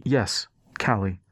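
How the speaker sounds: slow, mildly grave realization; slow down and let it turn serious. A narrator's reading. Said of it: "His tone turned grave".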